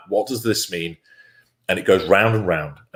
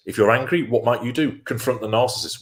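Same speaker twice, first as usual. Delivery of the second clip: usual speaking voice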